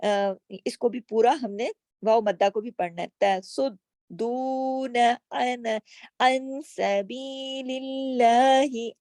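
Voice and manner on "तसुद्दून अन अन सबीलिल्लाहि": slow drawn tajweed chant; chanted verse; Quran recitation. Shown as a demonstration. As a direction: recite it stretched and measured.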